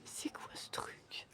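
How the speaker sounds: slow unvoiced whisper. Real, not acted. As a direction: whisper it slowly. Whispered aside.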